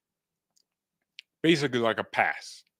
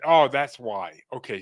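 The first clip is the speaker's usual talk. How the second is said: usual speaking voice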